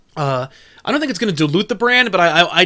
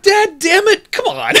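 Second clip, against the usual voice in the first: high, laughing mock exasperation; high-pitched, laughing "come on"; exasperated at dad; 13 semitones higher, slower than usual. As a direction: groan at your dad's joke, laughing. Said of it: "I'm like".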